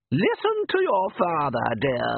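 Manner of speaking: high, slow motherly voice; doting mother. A narrator's reading. Mother soothes daughter, high and slow. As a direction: coo it like a loving mum. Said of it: "could only be a mother's voice".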